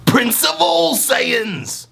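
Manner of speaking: very high, slow strained shout; hammed-up Prince of Saiyans yell; Vegeta voice. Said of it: "I can't do it right now".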